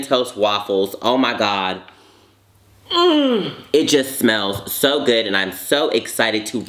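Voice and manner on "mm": high delighted hum. Real, not acted. Savoring smell.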